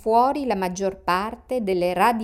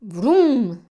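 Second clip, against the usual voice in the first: loud, higher, swelling vroom; imitated engine roar; 4 semitones higher, 6 dB louder than usual. A narrator's reading. A car drowns out Tom, loud engine noise. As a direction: imitate a car roaring past.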